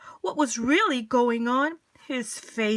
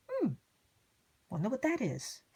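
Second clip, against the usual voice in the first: ordinary delivery, nothing marked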